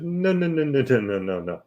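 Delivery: high, tumbling string of no's falling away; rattled-off mock objection, high then settling; retold quick correction. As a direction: imitate cutting someone off, starting high and dropping. Corrects the client in retold talk.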